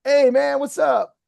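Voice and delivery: light up the face on "Hey, man, what's up?"; bright lifted greeting. Shown as a demonstration.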